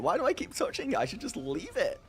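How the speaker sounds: ordinary delivery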